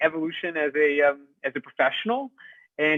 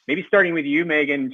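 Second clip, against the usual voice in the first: ordinary talk, nothing marked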